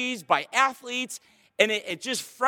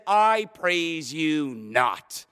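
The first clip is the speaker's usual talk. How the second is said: very slow, low disdain; slow, scornful pronouncement; disdainful; 5 semitones lower, much slower than usual. Acted. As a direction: draw out each word with cold disapproval. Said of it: "envision the disdain for the behavior in his tone".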